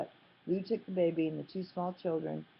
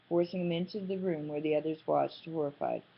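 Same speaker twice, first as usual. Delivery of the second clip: usual speaking voice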